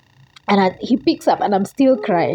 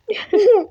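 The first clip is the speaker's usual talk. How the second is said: sobbing laugh; 5 dB louder than usual. Real, not acted. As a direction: laugh collapsing into a sob. Overjoyed, crying.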